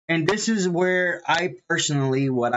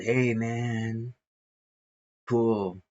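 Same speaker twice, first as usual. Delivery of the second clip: surfer dude; 3 semitones lower, 4 dB quieter, much slower than usual. Retold or imitated. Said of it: "the surfer dude".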